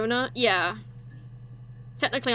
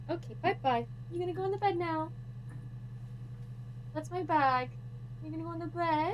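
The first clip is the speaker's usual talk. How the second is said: pet talk; 4 semitones higher than usual. Real, not acted. Praising and fussing over the cat.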